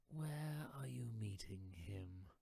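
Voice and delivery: slow hissing whisper; whispering snake. Acted. Snake whispering to the mouse, slow and hissing.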